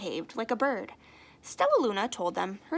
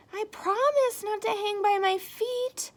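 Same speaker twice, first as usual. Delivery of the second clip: sighing Stellaluna; 11 semitones higher than usual. Acted. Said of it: "Stellaluna sighed".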